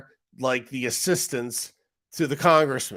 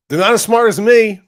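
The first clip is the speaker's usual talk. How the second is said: "They're not as smart as me" said much higher and louder with a falling "me"; mock boast; 10 semitones higher, 13 dB louder than usual. Real, not acted. Streamer boasting to chat, loud high mock brag. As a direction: boom out the brag, half joking. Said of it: "I don't consider myself super smart".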